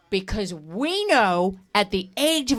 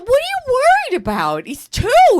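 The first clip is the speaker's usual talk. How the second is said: much higher and louder in disbelief; exasperated high retort; 6 dB louder than usual. Retold or imitated. Wife mocking husband's worry, high incredulous retort. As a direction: throw it back at him, incredulous. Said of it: "I said".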